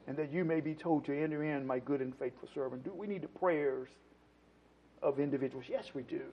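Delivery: very soft, low pulpit aside; subdued, reverent sermon tone; hushed preaching. Real, not acted. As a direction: bring the appeal right down, quiet and low. Hushed preaching after loud appeal.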